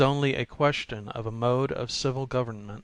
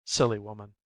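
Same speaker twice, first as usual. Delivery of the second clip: ordinary delivery, nothing marked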